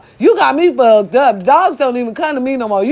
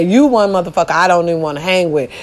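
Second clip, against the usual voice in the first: normal talking voice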